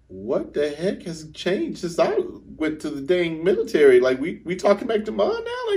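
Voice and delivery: high, loud imitated disbelief; incredulous brother. Brother stunned at sibling talking back to mom, incredulous. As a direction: act out shocked bafflement at full volume.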